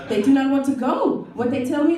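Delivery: ordinary talk, nothing marked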